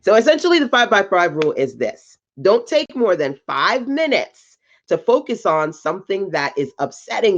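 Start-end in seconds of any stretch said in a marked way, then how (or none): none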